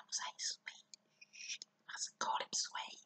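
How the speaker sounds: hushed whisper, no voice. Acted. Reading to a child, a secret whisper.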